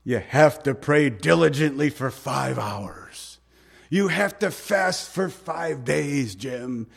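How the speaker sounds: slow, drawn-out mock tempter; Satan's discouraging voice. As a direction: lay down impossible demands, word by slow word. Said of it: "Satan tells you that".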